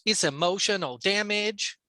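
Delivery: exaggerated Chinese-accented catchphrase. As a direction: imitate a comedian's put-on Chinese accent. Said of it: "a stereotypical Chinese accent".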